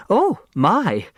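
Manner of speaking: a high shocked oh my; shocked gasp. Acted. Flora Bombach gasps behind her hand at a swear word. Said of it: "clapped a hand to her mouth".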